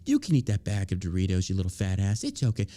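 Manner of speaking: low, indulgent mock reassurance; mock coddling. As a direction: coddle them sarcastically in a low voice. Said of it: "Interesting how that dude coddling".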